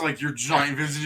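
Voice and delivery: ordinary talk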